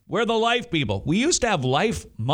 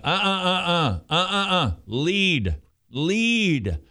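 feverish urging; 4 semitones higher, slower than usual. Pretend. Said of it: "perhaps a little too feverish".

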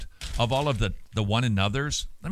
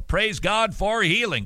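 higher, louder and slower, leaning on GOD; fervent praise; 9 semitones higher, 5 dB louder than usual. Real, not acted. Host praising God to listeners, louder and slower. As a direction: really praise God for healing. Repeats praise, far more forcefully.

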